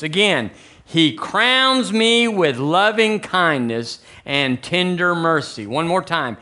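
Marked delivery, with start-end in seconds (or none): 0.8-5.7 slow, drawn-out, higher proclamation; led declaration. Real, not acted. Preacher leading the church in first-person scripture, slow declaration. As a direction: stretch each word for the congregation to repeat.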